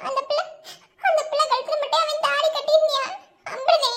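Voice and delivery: high, weepy protest; tearful wife answering her husband; crying. Acted. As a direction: push the words through sobs. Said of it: "அழாம பேசாம இருடி".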